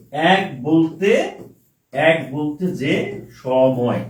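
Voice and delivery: very slow, word by word for writing; slow dictation. Shown as a demonstration. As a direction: draw each word out for the students to copy. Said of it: "তাহলে option হবে এরকম". (খুব ধীরে, লিখিয়ে দেওয়ার ভঙ্গিতে)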